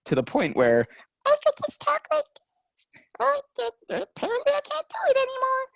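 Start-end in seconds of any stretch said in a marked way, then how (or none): 1.3-3.4 squeaky, very high voice. Shown as a demonstration. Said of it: "learn to pitch it up higher". 3.9-5.7 squeaky, very high voice. Shown as a demonstration. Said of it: "learn to pitch it up higher".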